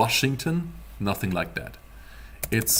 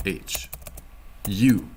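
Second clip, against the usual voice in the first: ordinary talk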